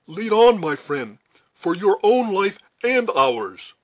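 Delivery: high, loud plea to move on; urgent command. A narrator's reading. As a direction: urge the guide on for dear life. Duncan urges the scout on.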